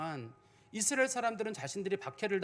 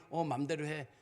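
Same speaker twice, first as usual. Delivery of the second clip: dismissive reply; 3 semitones lower than usual. Shown as a demonstration. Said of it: "이런 식으로 하면 안 되잖아요".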